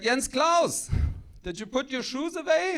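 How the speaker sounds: put-on high, sharp call to account in a German accent; mock stern German mother; strict German mom. German mom checks up on her son, strict and high-pitched. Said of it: "my mom was like".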